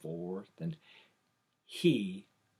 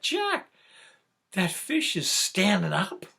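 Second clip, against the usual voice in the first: laughing, high and loud amazement; amazed cry; 10 semitones higher, 7 dB louder than usual. Retold or imitated. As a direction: shout it like you can't believe your eyes. Amazed at standing fish.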